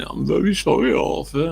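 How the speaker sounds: high, pleading apology; mock-pitiful apology from the old schoolmate; pleading friend. Friend pleading in retold quarrel.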